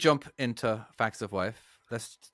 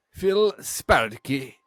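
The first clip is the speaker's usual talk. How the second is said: attempted Russian accent; 3 dB louder, much slower than usual. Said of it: "do an impression first".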